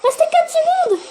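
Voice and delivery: high-pitched, plaintive gamer protest; incredulous and high, pleading with the viewers; dismayed disbelief. Real, not acted. Player disbelieves losing with seconds left.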